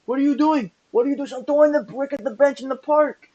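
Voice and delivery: high-pitched Italian mob boss outburst; put-on Italian mafioso boss accent. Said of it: "the Italian mafioso boss accent you give".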